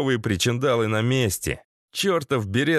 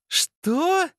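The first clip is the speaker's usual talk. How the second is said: very high female squeal of surprise; shocked exclamation. A narrator's reading. Masha hearing the captive's revelation, high shocked cry. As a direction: shoot the pitch right up in disbelief. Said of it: "воскликнула Маша".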